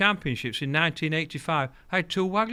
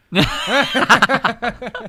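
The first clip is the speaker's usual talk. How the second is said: a loud burst of real laughter; 7 semitones higher, 8 dB louder than usual. Real, not acted. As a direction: crack up at your own punchline. Bursts out laughing at own story.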